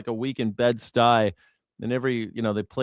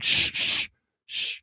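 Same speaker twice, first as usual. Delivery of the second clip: imitating church shushing; slower than usual. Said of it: "they're like".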